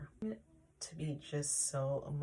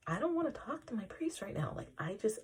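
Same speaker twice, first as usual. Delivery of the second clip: higher, strained, desperate; a frantic thought, pitched up; panicked inner voice; 6 semitones higher than usual. Retold or imitated. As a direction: plead with yourself to get away. Dodging the priest while sobbing.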